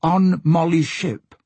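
drawn-out words from a lightly voiced porpoise; struggling porpoise. A narrator's reading. Porpoise finally tells Asta the message, struggling and slow. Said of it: "Arm hesitated, as if struggling with something".

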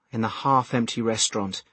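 usual speaking voice

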